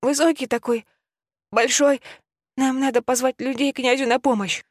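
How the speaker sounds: put-on sobbing child, high voice; high, tearful boy's voice through sobs; shaken tearful witness. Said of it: "всхлипывал Ника". (всхлипывающий мальчик)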